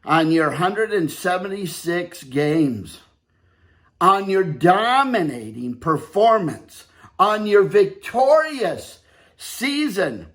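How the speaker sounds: loud, deliberate award speech; ceremonial speech. Acted. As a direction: present the trophy grandly. Formal congratulation speech.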